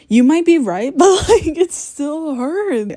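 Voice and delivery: loud, high, whiny protest with a laugh; laughing wail. Real, not acted. Vlogger answering being told to get a life, laughing wail. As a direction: wail it, dragging out the last words.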